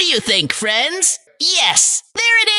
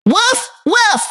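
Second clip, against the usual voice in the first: dog woof; 7 semitones higher than usual. Shown as a demonstration. Teacher voicing dog sound.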